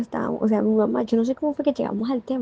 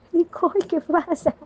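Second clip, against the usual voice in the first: worried question; 7 semitones higher than usual. Retold or imitated. Worried question to crying friend.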